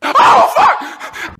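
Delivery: very high, loud mock scream; hammed-up wail. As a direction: shriek it like a panicking wife in a spoof. Dying king's wife reacts, acted.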